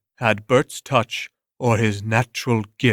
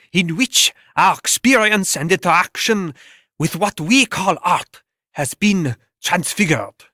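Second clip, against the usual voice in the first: higher, earnest voice of a rapt critic; gushing art critic; 6 semitones higher than usual. Acted. Art critic raving about Bert, raised and gushing. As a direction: gush over the genius like a critic. Critics praise the new visionary.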